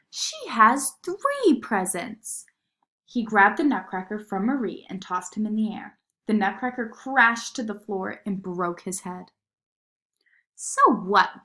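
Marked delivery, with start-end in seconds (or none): 0.1-2.5 put-on high, slow childish whine; whiny complaint. A narrator's reading. Fritz complaining about his sister's presents, whining. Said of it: "shouted Fritz". 10.5-11.4 loud mocking jeer. A narrator's reading. Fritz mocking Marie over the broken nutcracker, jeering. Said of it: "jeered Fritz".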